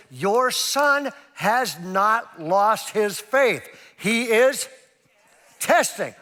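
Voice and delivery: high, loud, word-by-word declaration; slow and emphatic. Retold or imitated. Youth pastor spells it out for worried mother, slow emphasis. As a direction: spell it out very slowly and firmly.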